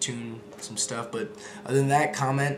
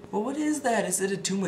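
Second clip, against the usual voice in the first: put-on much higher, questioning kid voice; high child voice; 9 semitones higher than usual. Kindergartner asking Arnold, high kid voice. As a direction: ask it like a curious little kid. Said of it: "they're just like".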